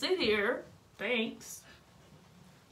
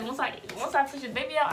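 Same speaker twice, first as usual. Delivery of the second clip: ordinary talk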